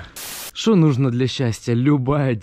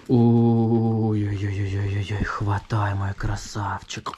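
cooing to the dog; 4 semitones lower, slower than usual. Real, not acted.

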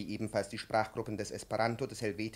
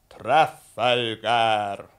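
very slow, loud, drawn-out proclamation; belted demo word; 3 semitones lower, 11 dB louder, much slower than usual. Shown as a demonstration. Professor demonstrating loud drawn-out declamation. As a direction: declaim each syllable at length. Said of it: "die Töne sehr hoch schmettern".

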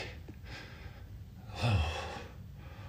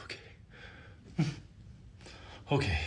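breathless whisper; gasping for air; out of breath; 15 dB quieter, slower than usual. Real, not acted. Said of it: "Catch my breath".

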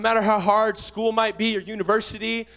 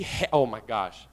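normal talking voice

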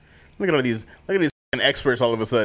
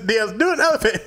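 normal talking voice

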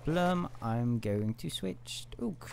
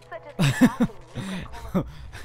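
laughing; 10 semitones higher, 6 dB louder than usual. Real, not acted. Laughing at physics.

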